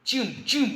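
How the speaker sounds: two high, short gunshot noises; imitated gunshots. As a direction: voice two quick shots. Spoken gunshot effect in comic.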